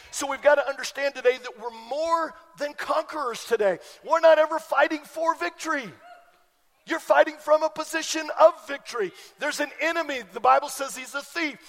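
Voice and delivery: rally the congregation, full voice; preaching. Acted. Preaching rises to full force.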